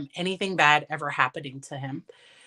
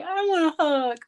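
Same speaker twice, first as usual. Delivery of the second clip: lightly voiced child; 9 semitones higher than usual. Voicing her young son.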